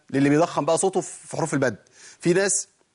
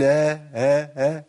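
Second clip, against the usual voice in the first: imitating a dazed man's vague ha ha; mock a bewildered person saying ha; a hint of a dazed man; confused person's haa; much slower than usual. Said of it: "لو واحد تايه".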